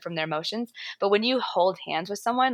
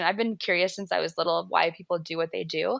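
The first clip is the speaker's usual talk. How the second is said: ordinary talk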